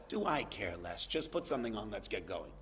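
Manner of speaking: muttered brush-off. Acted. Husband's dismissive reply to wife.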